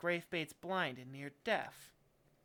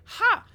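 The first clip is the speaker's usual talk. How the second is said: very high, loud scoffing burst; indignant "ha!"; 17 dB louder than usual. A narrator's reading. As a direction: bark out one scornful high cry. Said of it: "puffs up indignantly".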